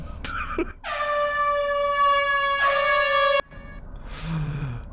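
long, very high, strained laugh; shrill laugh. Real, not acted. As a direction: let out a long high-pitched wail of laughter. Card sound won't stop.

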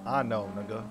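ordinary delivery

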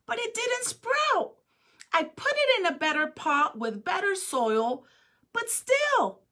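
put-on a child fighting back tears as he explains; tearful pleading. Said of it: "Ping started to cry and replied".

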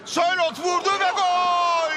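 loud, high goal shout; screaming football commentator. Real, not acted. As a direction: bellow the goal. Goal call.

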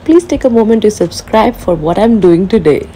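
loud, bright channel welcome; excited greeting. Real, not acted. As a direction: greet your viewers with big energy.

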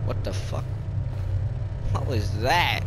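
breathless scared whisper; hushed what the fuck, frightened; whispered fright. Real, not acted. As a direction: gasp it under your breath, spooked. Startled by creepy laugh.

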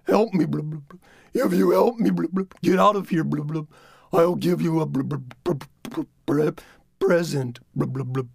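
bubbling words from underwater; gurgling underwater voice. Acted. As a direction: talk as if drowning in a stream. Coyote rock underwater.

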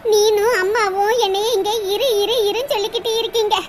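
ordinary delivery

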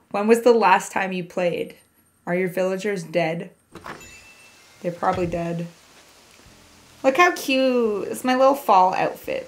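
ordinary talk, nothing marked

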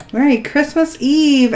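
usual speaking voice

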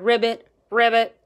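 lightly voiced ribbit; a mild froggy croak. Frog answering the fly, light croak. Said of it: "the frog answered".

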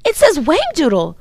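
put-on high, loud excited boy; thrilled, loud read-out; excited discovery. A narrator's reading. Excited find in the dictionary.

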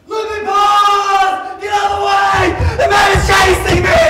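high, loud terrified shouts while fleeing; breathless panicked cries, running for your life; panicked shouting. Acted. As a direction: scream for help as you run.

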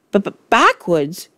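mildly flustered stammer. A narrator's reading. Wilfred answering the Trunchbull, stammering. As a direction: trip over the first word, baffled. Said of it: "stammered Wilfred".

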